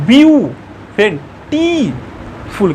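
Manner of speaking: ब्यू, टी, फुल each pronounced on its own; split into syllables. Shown as a demonstration. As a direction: stretch ब्यू and टी as separate beats. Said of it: "ब्यू भी एक अलग हो गया".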